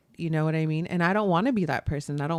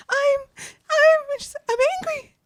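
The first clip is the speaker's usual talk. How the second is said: much higher cartoon princess insisting she's angry; mock angry princess; slower than usual. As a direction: do a high fairy-tale heroine trying to sound angry. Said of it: "I watched Enchanted the other day".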